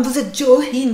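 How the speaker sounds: usual speaking voice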